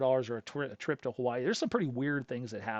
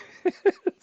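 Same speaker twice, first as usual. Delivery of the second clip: loud, high burst of laughter; real laugh; 11 dB louder than usual. Real, not acted. Storyteller amused by dealer's reaction, loud real laugh.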